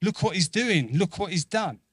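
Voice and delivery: imitating Peter dawning on it; Peter realizing. Peter in the boat catching on, mildly excited. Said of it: "I think he's like".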